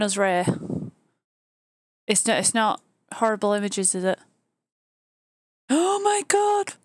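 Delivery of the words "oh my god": high, drawn-out Oh my God; excited gasp. Real, not acted. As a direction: gush over the dog link, much higher and slower. Reacting to a cute dog link.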